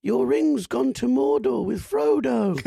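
hammed-up slow announcement, pitched high; slow prank-caller voice. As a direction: lay out the line slowly and theatrically, high up.

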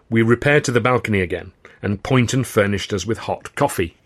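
plain reading voice

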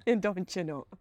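lightly exaggerated Wisconsin accent. Shown as a demonstration. Host echoing the accent joke, mock Wisconsin. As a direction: play up the homegrown Wisconsin sound. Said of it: "The Wisconsin".

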